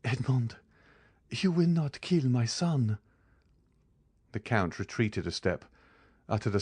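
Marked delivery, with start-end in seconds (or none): 0.0-3.0 pleading in despair, voice raised high. A narrator's reading. Woman pleading with the Count for her son, in despair. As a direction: beg him, slow and high, desperate. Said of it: "she said with an accent of despair".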